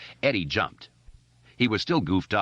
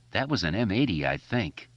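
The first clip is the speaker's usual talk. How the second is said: ordinary delivery, nothing marked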